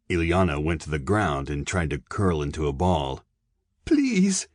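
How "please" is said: very high, pained begging; frightened victim's high-pitched plea; desperate plea. A narrator's reading. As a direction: cry it out pleadingly. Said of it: "the blows ceased".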